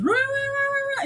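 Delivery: very high, rolling siren imitation; imitated alarm noise. Door opens and all you hear is this, imitated alarm. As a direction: wail like a tripped alarm. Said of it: "all you hear is".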